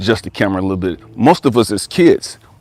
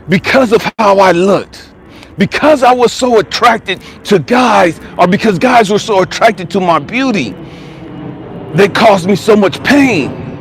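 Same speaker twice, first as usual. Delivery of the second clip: higher, emphatic preacher-like testimony; raised voice, voicing another's pain; impassioned retelling; 9 semitones higher, 3 dB louder than usual. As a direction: drive each reason home with force.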